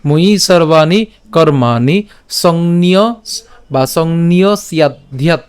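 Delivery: slow "ময়ি সর্বাণি কর্মাণি" with drawn-out vowels; pronunciation demo. Shown as a demonstration. Teacher repeats from "ময়ি" for learners, slow measured recital. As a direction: recite from "ময়ি" to "সংন্যস্যাধ্যাৎ" slowly in chunks. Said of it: "আবার যদি প্রথম থেকে বলি".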